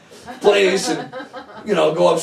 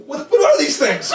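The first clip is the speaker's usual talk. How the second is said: baffled; 11 semitones higher than usual. Retold or imitated. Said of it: "I'm like".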